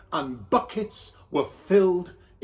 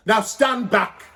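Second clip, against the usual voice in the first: louder, bossy helper; Doctor Bev's voice; 4 semitones higher, 5 dB louder than usual. Acted. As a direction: take charge of the room. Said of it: "said Doctor Bev".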